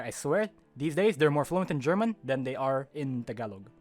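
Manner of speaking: usual speaking voice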